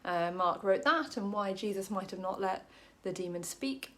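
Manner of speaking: normal talking voice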